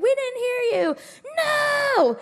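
put-on high, loud shout of children; kids yelling. Children shouting no at the presenters, loud and high.

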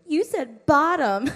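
put-on high, loud, slow kid tattling; giggly kid. Kids explaining why they laugh, high and giggly. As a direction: say it like a giggling kindergartner.

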